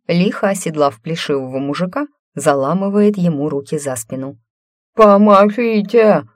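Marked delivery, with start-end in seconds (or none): 4.9-6.2 muffled cry through a gag; gagged. Acted. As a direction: yell for help through a gag. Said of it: "промычал через кляп".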